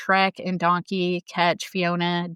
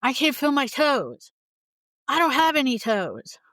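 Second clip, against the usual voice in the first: higher, put-on Donkey voice; playful higher Donkey impression; 7 semitones higher than usual. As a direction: mimic a dazed Donkey, raised voice. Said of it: "the part where he says".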